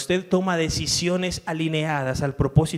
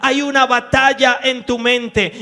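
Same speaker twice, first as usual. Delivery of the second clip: raised, loud scripture reading with driving force; fervent preaching; 9 semitones higher, 9 dB louder than usual. Acted. Pastor reading Paul's letters aloud to the church, fervently. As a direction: proclaim each verse to the back row.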